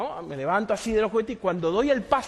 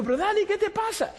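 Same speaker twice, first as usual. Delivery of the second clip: much higher, urgent pleading question; alarmed question; 12 semitones higher than usual. Retold or imitated. Retelling his alarm at Dani crying. (pregunta alarmada y aguda)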